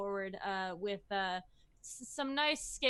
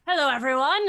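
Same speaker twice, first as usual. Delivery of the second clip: louder, cheerful hello to the crowd; loud greeting; 5 semitones higher, 10 dB louder, faster than usual. Acted. Farmer greeting the village crowd, loud and cheerful. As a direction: call out to the whole village.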